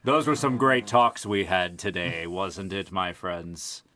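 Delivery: grand host announcing the sign-off; mock-formal announcer wrapping up; show outro. Host signing off the podcast to listeners, announcer style.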